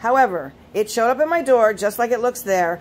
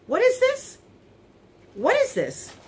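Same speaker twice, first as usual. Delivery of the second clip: high-pitched puzzled question; genuinely confused, voice jumping up; real bafflement; 10 semitones higher than usual. Real, not acted. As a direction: ask it high, thrown by what you found. Baffled by unexpected bonus bundle.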